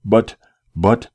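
breathless, gasping out the words; panting. A narrator's reading. Desperate captive gasping at his calm captor, panting. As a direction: stammer it out short of breath. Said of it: "I panted".

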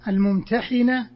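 الممتحنة with a short i after the ح; kasra pronunciation. Shown as a demonstration. Teacher explaining the active participle, الممتحنة with kasra, "-hina". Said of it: "بالكسر".

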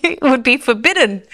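high, amused exclamation through laughter; laughing disbelief. Retold or imitated. Newcomer recalls her wrong view of church, laughing disbelief. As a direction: say it as if scandalised, laughing at yourself.

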